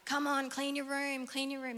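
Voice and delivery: high, lightly voiced nagging mum; nagging parent. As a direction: nag a messy child, lightly.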